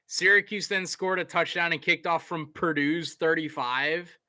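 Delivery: ordinary talk, nothing marked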